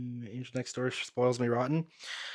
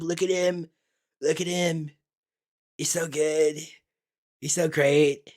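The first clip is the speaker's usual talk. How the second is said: higher, mock-starstruck teenage gush; teen fanboy gushing; 3 semitones higher, slower than usual. Teen self gushing over the main character, mock-awed. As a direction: ham up your younger self swooning over him. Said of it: "And I was like me, as, like, a teenager".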